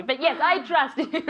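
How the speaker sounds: ordinary talk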